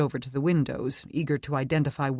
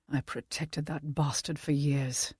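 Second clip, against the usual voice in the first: put-on British accent; 6 dB quieter than usual. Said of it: "British accent".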